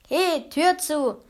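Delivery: high, drawn-out shout in a character voice; goblins calling out. A narrator's reading. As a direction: holler slowly from inside. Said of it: "riefen die Kobolde von drinnen".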